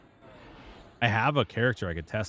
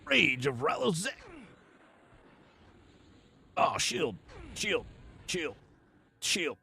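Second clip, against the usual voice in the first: weird voice; 10 semitones higher, slower than usual. Pretend. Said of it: "In maybe some weird voice".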